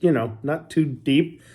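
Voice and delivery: usual speaking voice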